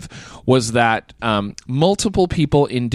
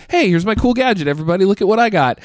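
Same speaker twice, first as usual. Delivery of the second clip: eager show-off; 8 semitones higher, faster than usual. Retold or imitated. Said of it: "I wanna be like".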